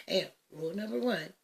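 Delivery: ordinary talk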